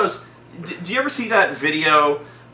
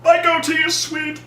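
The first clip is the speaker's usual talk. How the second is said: hammed-up defensive yell; mock wounded outrage; 13 semitones higher than usual. Zod defending his goatee, outraged yell.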